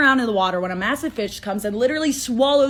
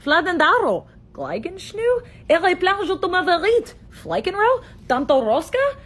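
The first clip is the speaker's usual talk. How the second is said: fake gibberish language; 6 semitones higher, slower than usual. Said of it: "no one knew what anyone else was saying".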